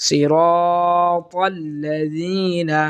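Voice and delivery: সিরা held one beat, chanted slowly; one-alif stretch. Shown as a demonstration. Teacher showing the one-alif stretch. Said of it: "টানবো এক আলিফ".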